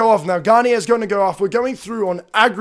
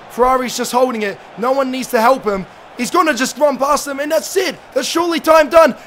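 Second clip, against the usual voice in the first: ordinary talk, nothing marked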